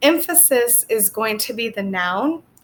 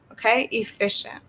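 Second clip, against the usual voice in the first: ordinary talk, nothing marked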